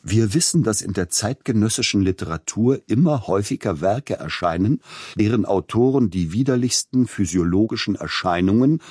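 relishing every word of a mocking review; savouring every word. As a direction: quote the review with gleeful, gloating emphasis. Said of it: "jedes Wort mit Genuss betonend".

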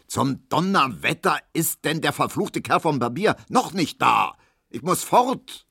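gebrüllter Zorn, höher; brüllend. A narrator's reading. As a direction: polter ungeduldig los. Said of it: "hörte man Herrn Markowski brüllen". (Herr Markowski brüllt nach dem Barbier, höher)

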